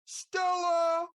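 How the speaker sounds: a high, loud bellowed Stella in a changed voice; Marlon Brando's Stella. Retold or imitated. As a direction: holler the name like the famous drunk husband calling up the stairs. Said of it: "once famously played by Marlon Brando".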